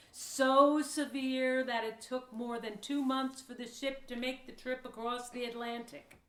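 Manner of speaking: normal talking voice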